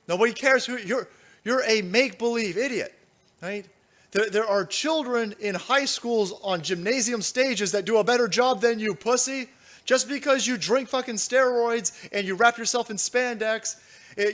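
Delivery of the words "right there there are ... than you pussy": ordinary talk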